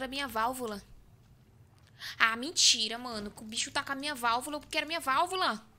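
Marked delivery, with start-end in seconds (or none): 2.2-5.7 frustrated whining. Real, not acted. Monster has his valve.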